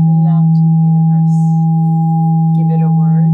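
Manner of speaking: slow meditation voice over bowl; guided meditation. Acted. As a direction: guide each breath, unhurried.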